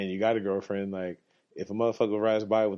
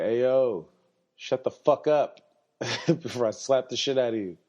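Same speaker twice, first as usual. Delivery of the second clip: higher, louder mock threat; retold threat; 4 semitones higher, 4 dB louder than usual. Imitating himself yelling at a homeless man, mock angry threat. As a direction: snap it at him like a fight starting.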